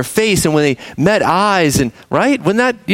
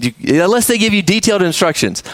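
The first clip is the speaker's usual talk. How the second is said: ordinary talk, nothing marked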